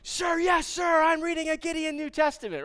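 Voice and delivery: high, loud drilled monotone shout; recruit's shouted reply. Retold or imitated. Trainee reports to commanding officer what he reads, shouting. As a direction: report at full volume like a recruit. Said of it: "I just imagine him saying".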